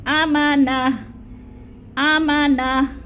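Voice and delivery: आमाना with the final syllable cut to a short "na"; pausal reading. Shown as a demonstration. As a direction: stop on आमाना, ending plain "na". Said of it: "वक़फ़ की सूरत में? कैसे पढ़ना है". (वक़फ़ की सूरत में आमाना)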